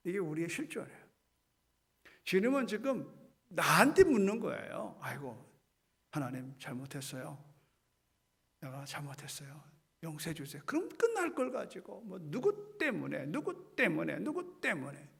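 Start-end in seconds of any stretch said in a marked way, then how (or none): 5.0-10.6 low, soft repentant plea; humble plea. Acted. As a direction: confess quietly like a sorry child. Humble confession to God. (낮고 조용히 회개하듯)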